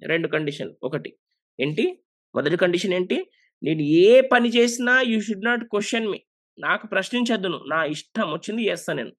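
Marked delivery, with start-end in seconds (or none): 3.6-6.2 high, loud and slow, each word pressed; slow emphatic demand. Acted. Ganga laying down her condition to the king, slow and loud. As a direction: lay down the condition firmly, word by word.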